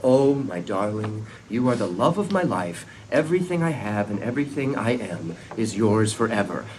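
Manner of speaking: hamming up a lovesick vow; mock romantic swoon. Ted mocking the lovesick guy he won't be, mock-tender. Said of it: "I'm just like".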